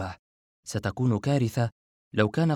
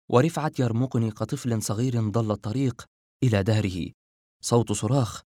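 ordinary delivery

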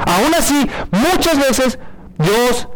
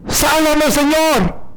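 Jonah's plea; 7 semitones higher, much slower than usual. Retold or imitated. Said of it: "Jonás dice".

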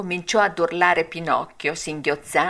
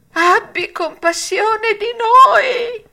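very high, loud, slow wail; whining fox voice; 7 dB louder, slower than usual. A narrator's reading. Fox wailing for pity at Pinocchio. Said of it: "voce di piagnisteo".

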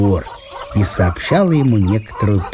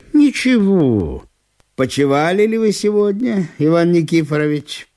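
higher, politely sing-song voice; polite fussy visitor; 8 semitones higher than usual. A narrator's reading. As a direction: ask sweetly and courteously, higher. Ivan Ivanovich speaks.

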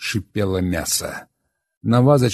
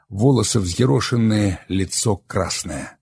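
ordinary delivery, nothing marked

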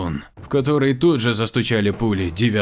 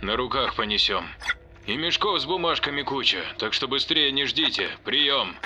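crackly military radio; 6 semitones higher, 4 dB quieter than usual. Acted. Reply heard over radio.

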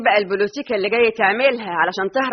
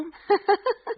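mocking laugh; 10 semitones higher, 3 dB quieter than usual.

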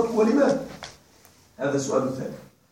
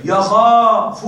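drawn-out prompt; 4 dB louder, much slower than usual. Shown as a demonstration. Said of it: "ها،".

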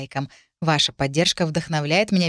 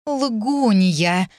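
drawn-out venomous word, a little higher; hissed accusation; 4 semitones higher, 4 dB louder than usual. A narrator's reading. Stepmother turns on the heroine, hissing. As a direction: hiss it slowly with loathing. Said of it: "прошипела Моргана".

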